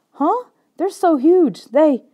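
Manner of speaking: high, loud, frightened yelp; panicked outcry. A narrator's reading. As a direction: blurt it out in alarm at the huge birds. Boy panics at giant birds.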